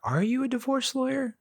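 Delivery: high, mocking jab at the lawyer; exasperated jab. Divorced dad venting at his lawyer, sarcastic. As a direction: needle the lawyer with a sarcastic question.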